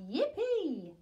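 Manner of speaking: high gleeful yippee; excited cheer. A narrator's reading. Dinosaur sisters cheering as the tree falls, high and gleeful. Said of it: "the T's cried out".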